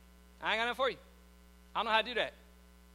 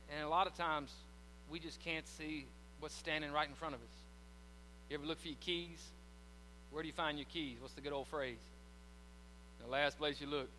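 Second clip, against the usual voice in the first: lower, softer, weary preaching voice; somber confession; 8 semitones lower, 7 dB quieter than usual. Acted. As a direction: confess the struggle quietly.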